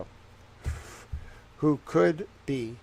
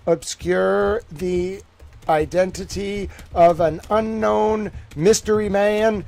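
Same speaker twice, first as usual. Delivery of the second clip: rising, louder and slower, building to a big reveal; dramatic proclamation; 6 semitones higher, 8 dB louder, slower than usual. Real, not acted. Streamer unveiling his theory to viewers, dramatic building proclamation. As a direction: announce it like a breaking headline, higher and slower.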